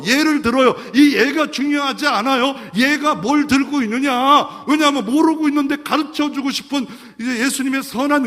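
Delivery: loud, high emphatic preaching; fervent sermon emphasis; preaching at full force. Acted. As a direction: drive the point home from the pulpit.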